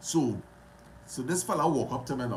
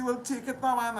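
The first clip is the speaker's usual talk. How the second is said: eager offer; 12 semitones higher than usual. Retold or imitated. Retold dialogue giving chain away.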